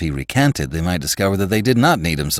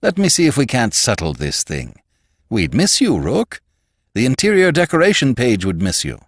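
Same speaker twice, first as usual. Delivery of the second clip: coaxing boss; 7 semitones higher, 4 dB louder than usual. A narrator's reading. Boss coaxes employee to stay.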